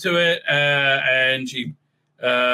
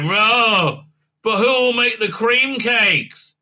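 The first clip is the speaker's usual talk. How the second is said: higher, slow, drawn-out fretting; mock Tilly wail; 7 semitones higher, much slower than usual. Tilly frets over who will make the cream cakes, in a mock wail. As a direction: put on an exaggerated, higher-pitched worry.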